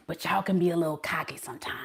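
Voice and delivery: whispered fast aside; teasing whisper. Real, not acted. Whispering a tease at the Leo viewers. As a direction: let the jab slip out in a whisper. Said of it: "whispered teasing aside".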